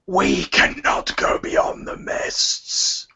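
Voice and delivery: slow, voiceless croak; drawn-out eerie rasp; rasping creature. Acted. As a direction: rasp it slowly like a frog-throated monster. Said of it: "a rasping voice goes".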